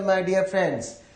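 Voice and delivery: ordinary delivery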